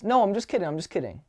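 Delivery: quick bright "just kidding" after a tease; playful take-back. Acted. Man to the girl he teased, playful take-back. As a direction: laugh off the tease and pull her back in. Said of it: "what I should have done is".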